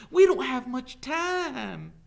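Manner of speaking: high, loud, drawn-out urging; urgent plea. A narrator's reading. Teacher urging the sheriff to hurry, desperate. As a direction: press him desperately to act. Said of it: "urged Catherine".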